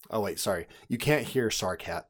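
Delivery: ordinary talk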